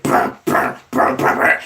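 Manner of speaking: unvoiced lip-trill brr brr; mock engine rev. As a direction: buzz your lips like a low rider idling. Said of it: "Compton [name] be like".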